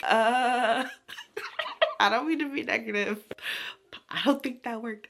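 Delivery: hesitant, through a laugh, slowing; mock skeptical boyfriend; awkward hero. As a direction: let him down gently while laughing. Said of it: "And he's like".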